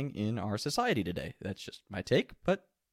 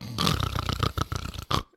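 violent, rattling snore; snorting, choking sleep-apnea snore; 3 semitones lower than usual. Retold or imitated. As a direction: imitate someone snoring like they're choking. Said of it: "it sounds like he's choking".

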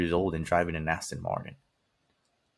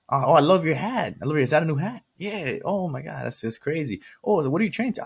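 higher, louder, gushing small talk; bubbly gym chat; 6 semitones higher, 4 dB louder than usual. Retold or imitated. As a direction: gush over a stranger's new hat. Acted upbeat gym chat.

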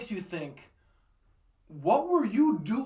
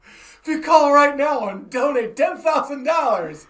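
infomercial voice; 6 semitones higher, 9 dB louder than usual. Retold or imitated. Infomercial parody.

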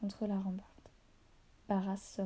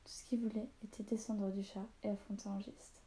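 usual speaking voice

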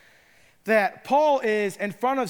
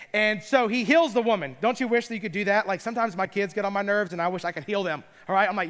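usual speaking voice

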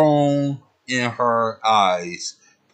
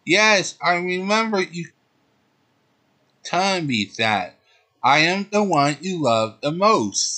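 higher heroine voice, a touch of sadness; curious but sad; 7 semitones higher than usual. Acted. Sailor Moon asks her love if she matters most, mildly sad and curious. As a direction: ask wistfully, lightly sad. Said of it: "Sailor Moon asked in a curious but sad tone".

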